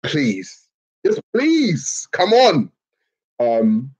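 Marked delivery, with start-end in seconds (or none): 0.0-2.7 rising, much higher pleading please; mock desperate pleading; pleading fan. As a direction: beg the team like a nervous fan. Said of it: "I'm just like".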